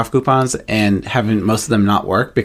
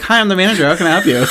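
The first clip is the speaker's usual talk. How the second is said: high, perky customer-service greeting; mock-cheery manager voice, pitched up; manager personality shift; 7 semitones higher, faster than usual. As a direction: switch into a put-on helpful manager. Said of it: "Personality shift".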